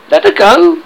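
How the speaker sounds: high, loud, rising question; outraged cry. A narrator's reading. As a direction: cry out in disbelief. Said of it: "cried Hook".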